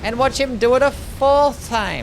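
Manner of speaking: high, loud, drawn-out complaint; exasperated yelling. Real, not acted. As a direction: wail it in disbelief at the game. Exasperated at boss repeating its attack.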